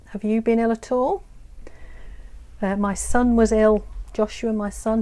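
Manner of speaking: ordinary talk, nothing marked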